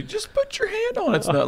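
mock expert advice. Mocking ocean experts' advice about sharks, high and laughing. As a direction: mimic a know-it-all telling you to touch the shark.

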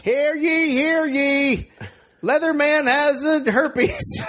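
high, slow, shouted proclamation; town crier. Pretend. Announcing news like a crier.

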